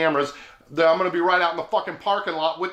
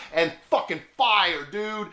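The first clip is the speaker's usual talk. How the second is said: plain reading voice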